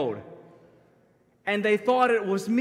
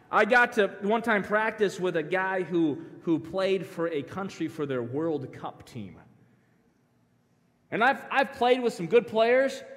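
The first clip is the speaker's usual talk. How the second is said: ordinary talk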